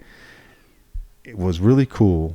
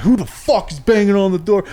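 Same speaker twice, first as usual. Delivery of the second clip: high, irate officer impression; mock mad yelling; 11 semitones higher, 4 dB louder than usual. Pissed officer yelling at inmates banging on the door, mad. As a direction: imitate an angry man demanding answers. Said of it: "He's, like, all mad".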